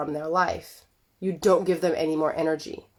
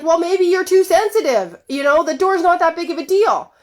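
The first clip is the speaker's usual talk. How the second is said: much higher and louder mock patronizing husband; husband dismissing her feelings, high and loud; condescending husband; 9 semitones higher, 6 dB louder than usual. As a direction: brush her off as too sensitive. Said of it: "And he's like".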